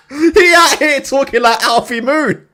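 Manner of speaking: high, slow, laughing through the words; real laughter breaking into the jibe. Real, not acted. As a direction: talk while cracking up. Cracking up at his own jibe.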